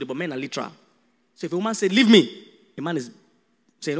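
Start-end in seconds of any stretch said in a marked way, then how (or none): 1.9-2.5 loud, sharp leave me; huffy demand. Acted. Upset wife tells husband to leave, loud huff.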